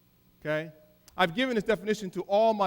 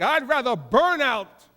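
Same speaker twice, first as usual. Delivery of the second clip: proud slogan; 3 semitones higher, 6 dB louder, slower than usual. Retold or imitated. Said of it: "we'll say things like".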